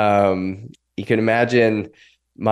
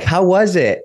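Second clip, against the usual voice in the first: high, louder excited question; eager greeting; 10 semitones higher, 5 dB louder than usual. Retold or imitated. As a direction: imitate an expectant wife, pitched way up. Wife eagerly greets him home.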